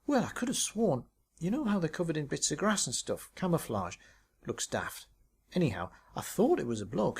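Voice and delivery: breathless, proud of her shot; excited Tracy. Acted. Tracy voiced, excited story.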